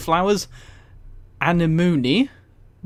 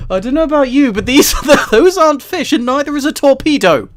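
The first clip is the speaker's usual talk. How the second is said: higher and louder, laughing disbelief; incredulous protest; 8 semitones higher, 7 dB louder than usual. Real, not acted. To chat, amazed that snail and wolf are listed as fish, incredulous protest.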